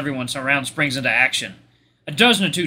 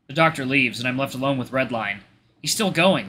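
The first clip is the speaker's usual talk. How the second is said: usual speaking voice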